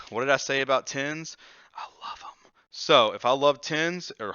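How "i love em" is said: whispered aside. Real, not acted. Player confiding his love of tens to viewers, whispered. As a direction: breathe it as a secret.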